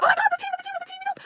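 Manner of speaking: very high squealed reenactment of fangirl panic; freaking out. Retold or imitated. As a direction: squeal it over and over, frantic. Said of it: "She's running around freaking out".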